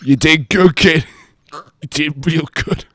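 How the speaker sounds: loud, hammed-up mentor blessing; mock dying mentor.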